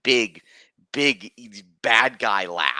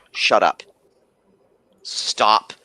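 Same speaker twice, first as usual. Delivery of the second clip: a louder, firm hush to stop the reading; mock shushing; 5 dB louder, slower than usual. Players telling the guy to stop reading, loud mock hush. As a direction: clamp down on him to make him stop. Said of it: "they're telling this other guy".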